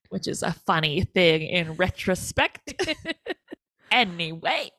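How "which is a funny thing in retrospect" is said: usual speaking voice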